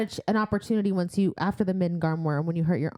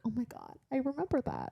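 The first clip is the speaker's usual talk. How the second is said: quiet mock Feyre; 6 semitones higher, 9 dB quieter than usual. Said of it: "And she was like".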